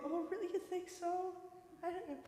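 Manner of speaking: high, soft, coy reply; imitating a shy flattered customer; coy flattered woman.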